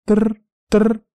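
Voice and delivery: high trilled ringtone noise; imitated phone ring. A narrator's reading. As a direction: roll it high like a ringing phone. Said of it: "Зазвонил телефон у Юны".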